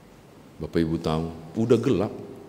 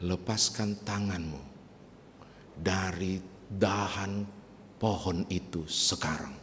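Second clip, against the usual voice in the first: softer, slow divine command; calm voice of God; 2 semitones lower, 9 dB quieter, slower than usual. Acted. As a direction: speak as God, gently but firmly. God's voice answers the climber. (suara Tuhan yang tenang dan lembut)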